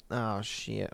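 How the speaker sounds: ordinary talk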